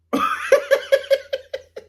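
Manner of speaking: high-pitched burst of laughter. Real, not acted. Bursts out laughing mid-remark. (éclat de rire aigu)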